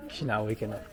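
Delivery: normal talking voice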